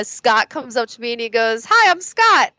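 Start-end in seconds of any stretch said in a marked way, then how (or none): 1.7-2.5 high-pitched, louder hello, imitating him; mock eager greeting. Scott introducing himself at a first meeting, mock eager and high. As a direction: play an over-keen self-introduction, much higher.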